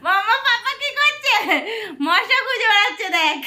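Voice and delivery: high, loud laughter through the words; laughing. Real, not acted. Mother laughs with her toddler at papa chasing mosquitoes. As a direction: burst out laughing while asking.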